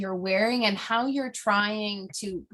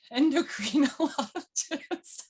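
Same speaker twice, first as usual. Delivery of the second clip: laughing; 7 semitones higher, slower than usual. Real, not acted. Laughs while stumbling over the word.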